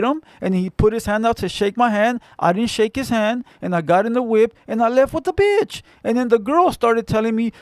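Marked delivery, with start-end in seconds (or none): none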